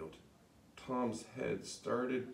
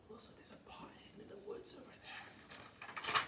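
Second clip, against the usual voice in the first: whisper; 12 dB quieter than usual. Real, not acted. Aside whisper to young listeners.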